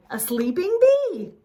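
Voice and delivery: high, loud eager guess; cried out. A narrator's reading. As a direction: proudly blurt the answer. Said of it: "Morris cried".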